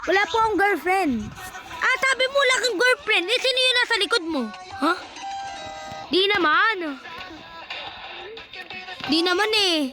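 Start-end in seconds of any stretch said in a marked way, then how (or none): none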